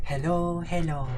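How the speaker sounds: light put-on Swedish accent. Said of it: "Not the Swedish accent".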